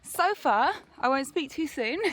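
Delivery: ordinary talk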